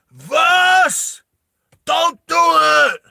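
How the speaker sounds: high, drawn-out shout; shouted warning. Acted. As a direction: yell to stop them from afar. Character shouting a desperate warning.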